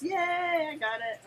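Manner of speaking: high, loud, slow yay; excited cheer. Real, not acted. Grandma cheering as she finds her prize. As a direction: cheer it out in delight.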